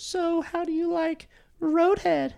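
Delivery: slow, high falsetto; very fake voice. Date propositioning the driver, slow fake falsetto. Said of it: "like, very fake sounding voice".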